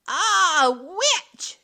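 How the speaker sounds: loud, high hammed-up shriek; mock horror shriek. A narrator's reading. Officer shrieking in mock fright at the witch. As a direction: fake a terrified scream. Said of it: "shrieked Officer Johnson in mock horror".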